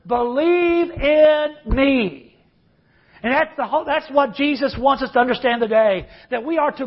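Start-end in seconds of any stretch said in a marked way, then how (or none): 0.1-2.4 drawn-out plea. Retold or imitated. Jesus's climactic plea, drawn out.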